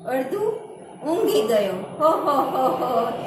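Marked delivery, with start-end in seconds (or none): 2.0-3.2 louder round "હો, હો, હો" laugh; ho ho laugh demo. Shown as a demonstration. Teacher demonstrating a laugh for the joke, booming ho-ho. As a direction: laugh "હો, હો" from the chest. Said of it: "એવી રીતે હસવાનું".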